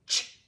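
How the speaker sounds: a short, sharp "ch" said alone; just the sound, "ch"; isolated ch sound. Shown as a demonstration. Teacher isolating the ch sound. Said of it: "make the sound of".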